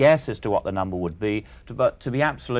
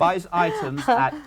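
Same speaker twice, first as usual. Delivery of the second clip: resume slowly, a little higher; slow dictation; 5 semitones higher than usual. Real, not acted. Questioner picking up the dictated sum, slowly. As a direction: spell out the problem at writing speed. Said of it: "I'll say it slowly".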